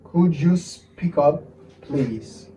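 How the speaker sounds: slow, word by word, softening on the last; careful slow teaching pace, dropping at the end; slow model phrase. Shown as a demonstration. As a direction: draw out each word for learners.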